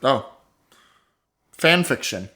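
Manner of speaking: normal talking voice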